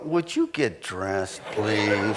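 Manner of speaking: wife waving off her husband, tired and amused; mock weary wife. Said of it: "you look at him and you go".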